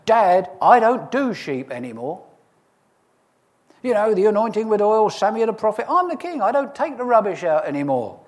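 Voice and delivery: a lightly voiced teenage David complaining to his dad; sulky teenager. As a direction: whine like a kid dodging chores. Preacher voicing a teenage David.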